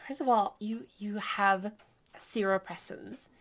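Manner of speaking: ordinary talk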